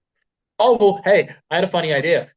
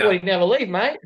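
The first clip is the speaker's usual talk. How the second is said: higher-pitched mock Australian accent; Slappy with Aussie accent; 6 semitones higher than usual. Co-host repeating the Aussie Slappy joke, mock Australian accent. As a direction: toss it off in playful Aussie. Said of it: "we talk like this".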